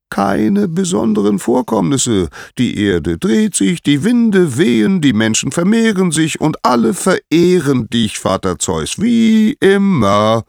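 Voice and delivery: very high, loud singsong answer; high, ringing herald's voice; Helios calling down. A narrator's reading. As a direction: call it down from the sky, stretching the last words. Voices Helios reporting from sky.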